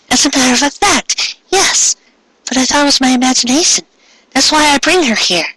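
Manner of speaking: put-on high, polite girl voice; a shy schoolgirl introducing herself; Ami's voice. A narrator's reading. Ami greeting the new girl, high and polite.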